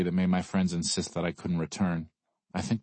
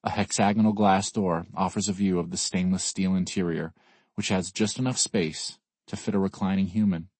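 usual speaking voice

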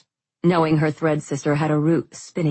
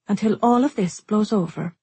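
resigned; 6 semitones higher than usual. A narrator's reading. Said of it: "tone final and shoulders sagging".

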